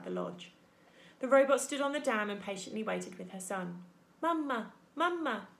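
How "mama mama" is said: put-on high baby-bird call for mother; higher, little chick voice; peeping gosling. A narrator's reading. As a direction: peep like a gosling. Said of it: "Brightbill started peeping".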